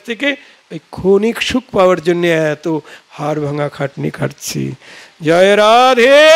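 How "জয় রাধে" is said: devotional call. Real, not acted. Preacher's drawn-out devotional call.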